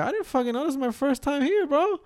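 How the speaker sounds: retold disbelief at the instructor; rising outraged protest. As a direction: pitch climbing up to a squeaky bro.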